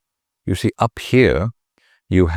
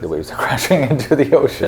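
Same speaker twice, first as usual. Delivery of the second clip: laughing mid-take; 8 semitones higher than usual. Real, not acted. Cracks up mid voiceover take.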